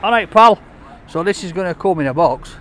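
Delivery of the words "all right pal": loud, higher-pitched greeting; calling out. Real, not acted. Vlogger hailing someone mid-review, calling out. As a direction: call out to a passer-by.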